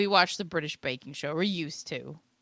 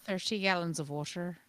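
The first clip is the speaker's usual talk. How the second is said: quiet, lilting Irish accent; 3 semitones lower, 10 dB quieter than usual. Shown as a demonstration. Tempting cohost into accents, soft Irish accent. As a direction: slip softly into Irish. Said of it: "will you do an Irish accent?".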